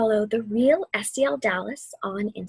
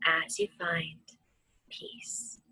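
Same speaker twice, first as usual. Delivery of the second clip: barely voiced, fading whisper; soft whisper; 4 semitones lower, 8 dB quieter, slower than usual. Teacher easing listeners into stillness, whispered. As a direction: let the words melt into breath. Meditation, final soothing words.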